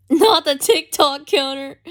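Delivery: high, loud, drawn-out protest; laugh, then wail it in mock dismay. Real, not acted. Streamer protesting the chat's TikTok counter, mock dismay.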